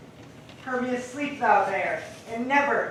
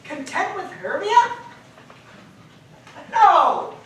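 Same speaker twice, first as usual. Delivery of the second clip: higher and louder, outraged at the thought; indignant shout; 9 semitones higher, 10 dB louder, slower than usual. Acted. Lysander scorning the idea of Hermia, indignant shout. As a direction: fling out the refusal.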